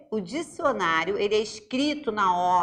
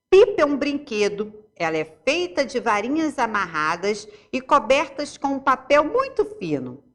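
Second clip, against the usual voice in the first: reading aloud; 4 semitones higher, 3 dB louder than usual. Acted. Reads dictionary entry aloud.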